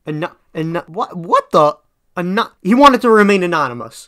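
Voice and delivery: frustrated outburst. Real, not acted. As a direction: snap at the word he can't say. Frustrated stumbling over a word.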